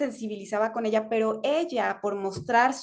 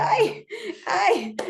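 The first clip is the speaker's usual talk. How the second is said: high, touched little cry of "ay"; a touched, half-tearful "ay, ay"; moved "ay, ay"; 10 semitones higher, 3 dB louder than usual. Retold or imitated. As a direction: act deeply moved by a child's words. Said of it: "Entonces dije".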